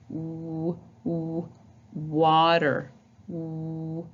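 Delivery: quiet rounded w; soft W sound. Shown as a demonstration. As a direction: round the lips, w. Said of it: "putting my lips together".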